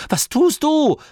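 high, loud call after her; alarmed shout. Acted. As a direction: yell after her as she runs off. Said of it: "rief Masklin".

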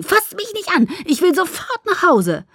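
high-pitched, upset protest; agitated, high demand; angry outburst. A narrator's reading. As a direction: snap at him to back off. Acted quarrel after the injury.

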